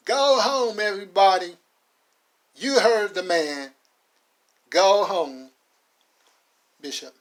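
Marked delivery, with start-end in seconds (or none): 0.1-5.5 high, loud call to send the crowd home; shouting to the crowd. Acted. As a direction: order them all to go home, full voice. Connolly tells the crowd leave.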